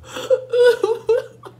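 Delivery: high wailing fake sob; fake crying wail. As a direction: wail to guilt her out. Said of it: "I cried like that".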